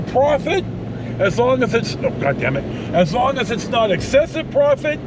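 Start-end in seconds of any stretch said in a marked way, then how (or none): none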